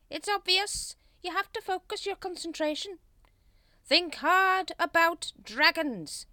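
high, loud pompous instruction; officious, emphatic leader voice; blustering Grand Master. A narrator's reading. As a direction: lecture dim followers from on high.

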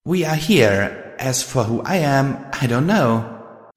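high, slow small voice; slow, dreamy little filly; slow little girl voice. A narrator's reading. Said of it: "the voice said".

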